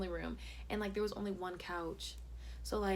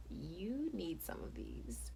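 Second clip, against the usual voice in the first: awkward hint; 9 dB quieter, much slower than usual. Retold or imitated. Acts awkward hint with mints.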